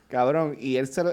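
ordinary talk, nothing marked

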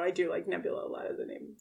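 ordinary delivery

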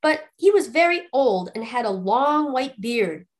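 ordinary delivery

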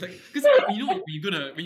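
laughing, high and loud. Real, not acted. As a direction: burst out laughing mid-sentence. Laughs while explaining.

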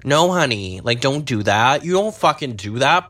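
exasperated scolding; scolding beachgoers. Retold or imitated. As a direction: tell them off like a sassy friend. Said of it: "and I was like".

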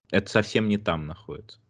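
usual speaking voice

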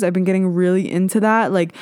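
ordinary talk, nothing marked

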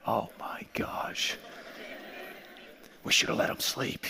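hushed, mortified whisper; embarrassed whisper. Retold or imitated. Embarrassed disciples whisper to each other as Jesus sleeps. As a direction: whisper it so he won't hear.

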